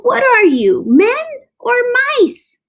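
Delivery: high, loud mouse scolding; squeaky rebuke. A narrator's reading. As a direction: shame them into coming back. Officer scolds fleeing troops.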